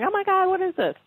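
mock freaking out. Interviewer voicing panicked cells to the guest, mock freak-out. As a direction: play at being alarmed, voice jumping up high. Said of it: "it's not like freaking out".

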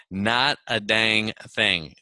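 slow, deliberate repeat; emphatic echo. Shown as a demonstration. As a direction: draw out each word of NOT A DANG THING. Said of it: "Just like that".

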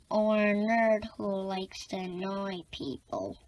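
ordinary delivery

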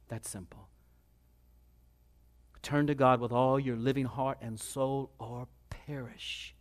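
soft, low, solemn warning; hushed preaching. Real, not acted. Preacher warns congregation to repent or perish, hushed preaching. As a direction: drop right down and let each phrase land gravely.

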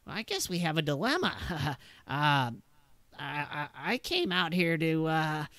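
a nervous gunman setting terms; wary NPC voice. Acted. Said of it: "he's like".

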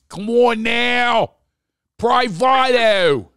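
high, loud, long-drawn Philly holler; Philly accent. Shown as a demonstration. Host finishing accent demo for guest, hollering in broad Philly. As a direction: holler it slowly in thick Philadelphia vowels. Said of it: "this is how we talk in Philly".

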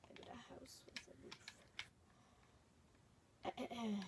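hushed fast whisper. Real, not acted. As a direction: whisper it quickly. Whispered aside.

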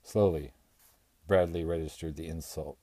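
ordinary delivery

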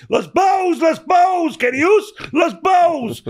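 put-on high-pitched shouted Spanish in a Cockney accent. Boy calling out Valenciano in town, high Cockney-accented shout. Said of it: "that was like a Cockney accent".

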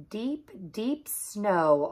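ordinary delivery

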